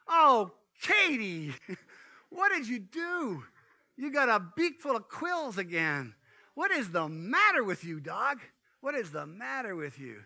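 high, exasperated scolding of the dog; exasperated dog owner. Retold or imitated. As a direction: scold the silly dog in disbelief.

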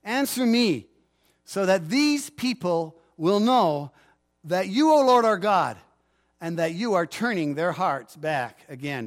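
fervent preacher reading the prayer aloud; impassioned prayer reading. Acted. Pastor reading Elijah's prayer to the congregation, fervent. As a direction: lift the prayer up with conviction.

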